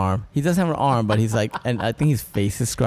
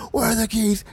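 high, strained mock yell; frantic high-pitched cry, not full volume; mock yelling addict; 12 semitones higher than usual. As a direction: imitate a desperate addict demanding keys. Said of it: "Actually yelling at each other".

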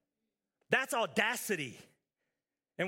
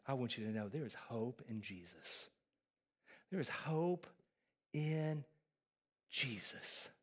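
tender reassurance; 8 semitones lower, 8 dB quieter than usual. Real, not acted. Pastor comforting hopeless believers.

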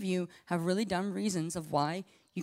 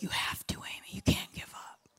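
whispered plea from a friend; urgent whisper; 7 dB quieter than usual. Retold or imitated. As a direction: whisper it, urging her not to quit. Friend urging her on.

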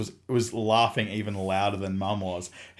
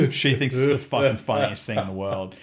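ordinary talk

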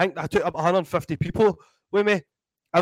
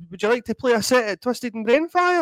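higher, upbeat offer voice; promoter asking; 8 semitones higher, 4 dB louder than usual. Retold or imitated. Promoter offering Mick a set at Twisted, higher and upbeat. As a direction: pitch the gig like a friendly promoter. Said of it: "He's like".

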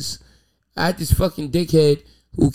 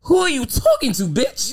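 indignant shout; 9 semitones higher than usual. Retold or imitated. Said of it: "repeats the retort".

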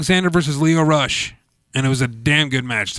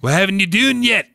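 a hammed-up, higher fake Irish accent; mock Irish brogue, played for laughs; bad Irish accent; 6 semitones higher than usual. Shown as a demonstration. Host jokes with co-host in a bad Irish accent. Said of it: "I'm talking in Irish".

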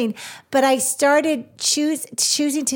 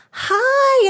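surprised hi; 13 semitones higher, 5 dB louder than usual. Retold or imitated. Retold own surprised greeting.

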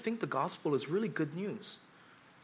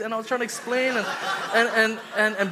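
laughing; 5 semitones higher, 5 dB louder than usual. Real, not acted. Laughing while retelling the blunder.

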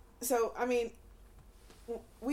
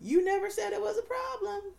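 mock oblivious partner; 7 semitones higher than usual.

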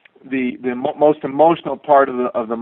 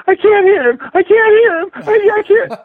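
very high, louder mock tearful wail; hammed-up distraught wife who can't hear; imitating sobbing wife; 6 dB louder than usual. Wife losing the call before his launch, mock sobbing very high. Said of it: "And then she starts to sob".

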